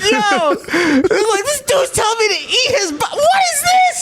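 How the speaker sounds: high, laughing near-shout; laughing disbelief. Retold or imitated. Disciple hearing he must eat flesh, laughing shout. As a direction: crack up in disbelief as you say it.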